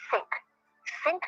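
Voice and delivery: "think" with an airy, voiceless TH. Shown as a demonstration. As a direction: demonstrate "think" with no voice on the TH. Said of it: "It's still the same sound".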